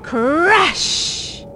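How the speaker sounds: storybook sound word; crash effect. A narrator's reading. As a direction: stretch the word like an impact. Fireball impact sound effect.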